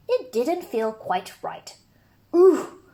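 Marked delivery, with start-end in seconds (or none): 2.4-2.8 high straining ooh grunt; effortful grunt. Acted. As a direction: grunt while lifting something heavy. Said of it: "grunted Douglas".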